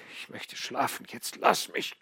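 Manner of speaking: whispered, fading out; barely breathing the words; faint whisper. A narrator's reading. Exhausted dying son sending his father away, whispered.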